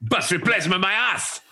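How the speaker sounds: high comic grumbling, edged with laughter; mock indignant complaint. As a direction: scoff loftily at the very idea. Acted commuter complaint.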